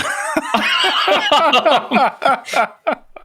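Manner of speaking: a long, high burst of laughter; big laugh. Real, not acted. Guest cracking up at racing a kid, long high laugh. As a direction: crack up at your own story.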